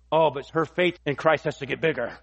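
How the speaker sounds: usual speaking voice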